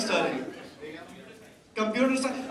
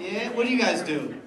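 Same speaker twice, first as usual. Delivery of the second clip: ordinary delivery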